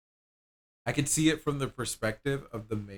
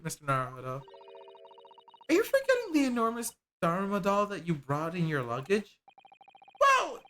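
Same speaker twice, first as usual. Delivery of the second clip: higher, animated character voices; two game characters bickering, voiced higher; voiced game dialogue; 8 semitones higher, 4 dB louder than usual.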